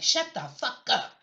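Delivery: mild imitated put-down; mock telling-off. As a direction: snap it like giving someone a slap. Imagined someone smacking Ted Cruz.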